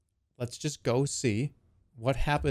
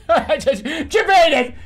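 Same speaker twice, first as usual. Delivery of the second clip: slow, loud and higher, through laughter; dying grandpa, laughing; 11 semitones higher, 10 dB louder, much slower than usual. Retold or imitated. Grandpa collapsing at dinner, slow loud words through laughter. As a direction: gasp it out like grandpa's last words. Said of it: "And then Grandpa goes".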